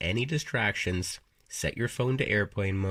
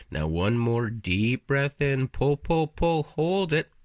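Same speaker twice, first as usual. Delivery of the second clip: stretched words climbing higher and louder; building breath coaching; 4 semitones higher, 4 dB louder, slower than usual. Real, not acted. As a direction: draw out each pull, rising towards the hold.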